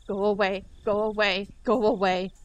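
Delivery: loud repeated yells; loud yelling. Acted. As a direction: shout her off the door. Keiichi yelling.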